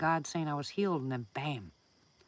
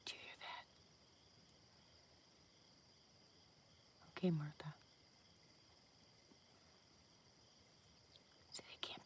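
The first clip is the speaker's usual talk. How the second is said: whispering; 18 dB quieter than usual. Real, not acted. Listening for the mouse.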